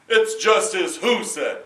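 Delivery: loud, slow demanding question; the king pounces on him; sharp king. Acted. King challenges the farmer.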